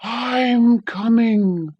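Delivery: the wind roaring its arrival; high loud slow wind call; shrieking wind. A narrator's reading. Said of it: "it shrieked".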